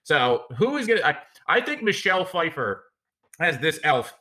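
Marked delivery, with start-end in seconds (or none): none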